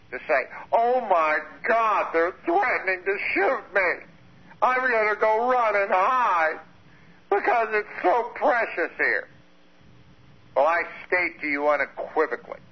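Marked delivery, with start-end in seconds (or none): 0.7-9.3 high, drawn-out mock wail; mock panic. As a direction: play the coward pleading for dear life. Mocking someone scared of threats.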